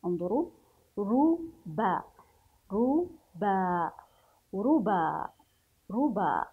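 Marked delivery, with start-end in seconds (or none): 1.0-3.9 ربا very slow, split as "ru... baa"; syllable-by-syllable reading. Shown as a demonstration. Teacher leads reading the words, slow syllable demo. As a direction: sound out ربا in two long syllables. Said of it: "هيا لنقرأ الكلمات".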